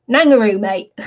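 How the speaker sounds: mock Kiwi accent, "Nangaroo" like kangaroo. Shown as a demonstration. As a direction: put on a playful Kiwi accent. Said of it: "say it with a Kiwi accent".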